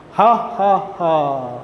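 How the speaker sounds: lightly voiced naughty laugh, falling; a hint of a mischievous chuckle; mock laugh. Teacher voicing the naughty T-shirt, mild mock laugh. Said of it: "and he is laughing".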